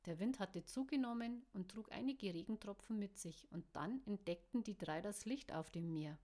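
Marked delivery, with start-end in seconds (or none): none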